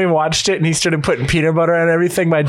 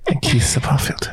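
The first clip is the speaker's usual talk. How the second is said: whisper; 3 semitones lower than usual. Real, not acted. Whispered confession to friends.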